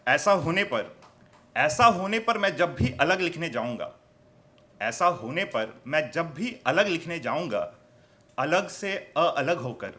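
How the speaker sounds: emphatic mushaira-style declaiming; poetry recitation. Acted. Poet shares his hope with the audience, emphatic recitation. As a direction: swell into each line, ease off at its end.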